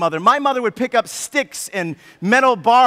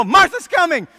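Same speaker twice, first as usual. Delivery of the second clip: higher, louder call of Martha's coming; shouted warning; 7 semitones higher, 4 dB louder than usual. Pretend. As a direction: yell it like running past. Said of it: "he yells it out".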